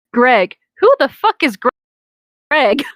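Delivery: ordinary talk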